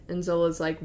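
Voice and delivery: ordinary delivery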